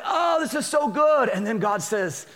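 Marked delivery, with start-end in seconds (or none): 0.0-1.3 high, slow, gushing delight; delighted dad. Retold or imitated. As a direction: gush like a new father. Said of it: "He's like".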